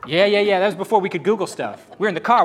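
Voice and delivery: ordinary talk, nothing marked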